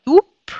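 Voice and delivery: "Doup" ends in a voiceless P; "Doup" with a sharp final P. Shown as a demonstration. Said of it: "ça devient B".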